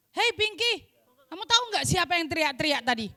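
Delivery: normal talking voice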